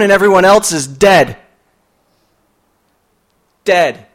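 high, loud urgent reading of a poem; dramatic poem reading. Acted. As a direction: read it like a thriller climax.

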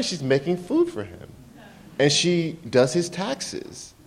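ordinary talk, nothing marked

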